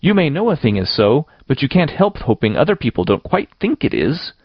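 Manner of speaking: put-on high-pitched, plaintive girl's voice; wailing self-defense. A narrator's reading. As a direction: plead your case, voice climbing high. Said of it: "wailed Anne".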